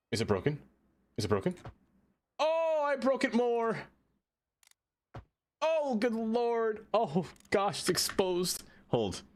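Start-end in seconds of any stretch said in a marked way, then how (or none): 2.4-6.9 high, very slow hammed-up wail; high mock wail. Breaks it worse in the game. 6.9-8.6 high squeaky dismay; hammed-up high fretting; mock dismay. Dismay at the broken track.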